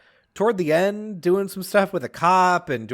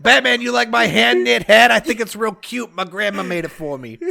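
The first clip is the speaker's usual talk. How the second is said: put-on Catwoman, Brooklyn accent; 5 semitones higher, 5 dB louder than usual. Showing Batman a knit hat, hammed-up Brooklyn accent. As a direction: start big in Brooklynese, then ease off. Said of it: "Brooklyn accent".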